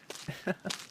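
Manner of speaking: a quick high soft laugh; soft playful giggle at the newcomer; soft teasing giggle. Acted.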